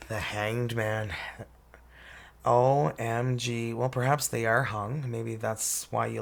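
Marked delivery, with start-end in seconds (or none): none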